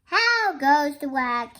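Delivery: loud farmer's call; projected storybook holler. Acted. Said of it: "and he called".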